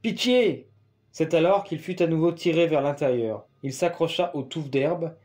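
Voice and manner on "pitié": yelled plea, held back in volume; cry for mercy. A narrator's reading. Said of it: "Il hurla". (cri de pitié, aigu)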